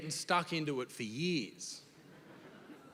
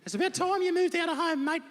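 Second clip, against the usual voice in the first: high, loud, laughing mock mum voice; hammed-up high mum, cracking up; mum's voice; 14 semitones higher, 5 dB louder than usual. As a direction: squeal it like a shrill mum, laughing. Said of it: "she said".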